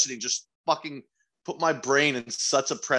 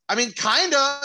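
high, unsure mumble; awkward reply; 11 semitones higher, 4 dB louder than usual. Retold or imitated. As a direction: sound caught off guard. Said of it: "I was like".

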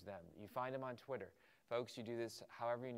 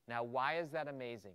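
usual speaking voice

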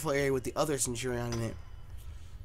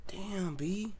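ordinary delivery

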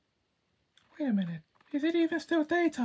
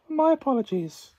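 ordinary talk, nothing marked

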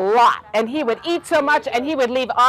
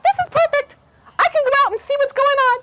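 very high, squeaky little mouse voice; high, quick mouse chirp; tiny mouse; 14 semitones higher, faster than usual. Acted. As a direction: pitch it way up like an excited mouse. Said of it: "a little tiny mouse".

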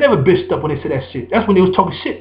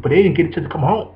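normal talking voice

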